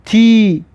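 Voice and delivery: تی said as tee with a clear ee vowel; teacher models تی as a crisp tee; kasra sound ti. Shown as a demonstration. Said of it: "کسره که دارد".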